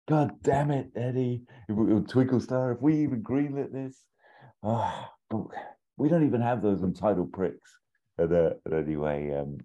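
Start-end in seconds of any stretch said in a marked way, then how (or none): 0.1-7.7 a boss who has had enough of this pitch; frustrated producer scolding the writer; exasperated producer. Retold or imitated.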